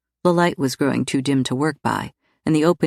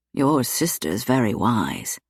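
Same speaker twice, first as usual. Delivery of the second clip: gently; 4 semitones lower, slower than usual. A narrator's reading. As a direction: ease her fear with a gentle word. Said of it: "he said gently".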